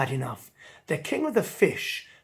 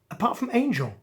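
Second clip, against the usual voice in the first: plain reading voice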